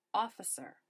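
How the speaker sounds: usual speaking voice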